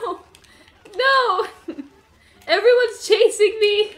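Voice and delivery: high, loud laughing no's; laughing panic, chased by everyone; panicked laughing. Real, not acted. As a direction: laugh while fleeing in a panic.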